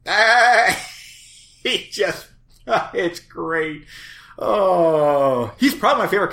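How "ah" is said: high sudden yelp; excited yelp. Retold or imitated. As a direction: yelp it like the fawn. Imitating fawn's cry again.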